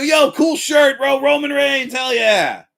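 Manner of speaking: loud, high, enthusiastic holler; hyped shout-out. Acted. Fan complimenting a stranger's wrestling shirt, loud and hyped. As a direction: call out a big friendly compliment.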